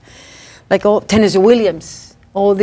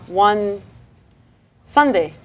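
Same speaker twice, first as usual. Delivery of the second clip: usual speaking voice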